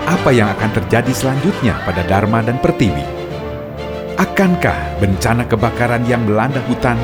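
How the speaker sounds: dramatic radio narrator; story narration. A narrator's reading. Narrator closing the episode.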